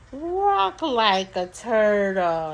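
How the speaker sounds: slow, loud, drawn-out game command; turtle slow. Retold or imitated. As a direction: stretch the words out like a lumbering turtle. Mother giving slow turtle command.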